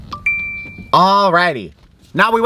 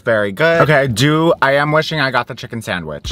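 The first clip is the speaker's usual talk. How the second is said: usual speaking voice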